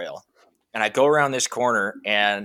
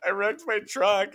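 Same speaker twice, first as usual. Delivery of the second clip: mock whimper; 10 semitones higher than usual. He answers wife, upset.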